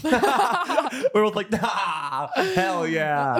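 laughing. Retold or imitated. Laughing retold.

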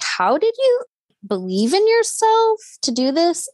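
high, amazed disbelief; amazed question. Retold or imitated. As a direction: ask it in wide-eyed wonder. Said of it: "I was like".

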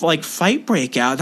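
normal talking voice

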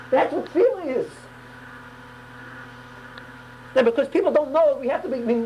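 high, fervent exhortation; impassioned preaching. Real, not acted. Preacher telling listeners what prayer is, impassioned.

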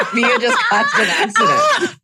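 high squealing laugh shout. Pretend. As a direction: shriek it through laughter. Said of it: "she's like".

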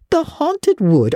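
high, slow disbelieving echo; stunned older woman repeating; astonished question. Acted. Marilla hears Anne fears the wood, astonished.

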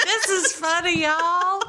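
put-on high, laughing Southern accent. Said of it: "flaunting my Southern accent".

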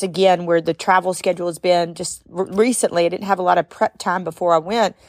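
normal talking voice

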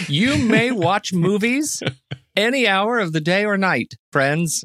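slow, high-pitched, grand declaration; mock constitutional proclamation. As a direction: proclaim it slowly like a solemn law, tongue in cheek. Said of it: "It is in the Constitution".

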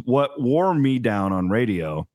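usual speaking voice

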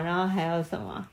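ordinary delivery

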